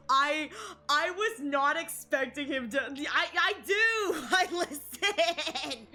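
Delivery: high, laughing fluster with drawn-out last word; real excited sputter rising into a laughing squeal; flustered excitement. Real, not acted. Fan stunned by a character's exit, flustered and giddy.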